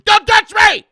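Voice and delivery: hammed-up high, very loud scream; outraged yell. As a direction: explode as a touchy fan. Imagined touchy fan snaps.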